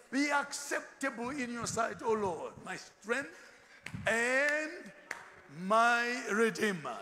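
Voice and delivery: slow, loud, drawn-out scripture reading; preaching scripture. Acted. Preacher reading Psalm aloud to his congregation, slow and loud. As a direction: proclaim the verse slowly to the congregation. Reads scripture in pulpit style.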